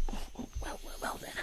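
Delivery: normal talking voice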